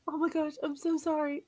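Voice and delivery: ordinary talk, nothing marked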